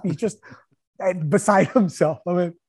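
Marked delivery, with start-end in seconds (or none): none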